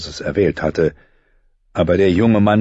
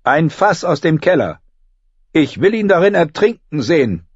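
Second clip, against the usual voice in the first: put-on cruel young king; 9 semitones higher than usual. A narrator's reading. Joffrey orders a cruel death.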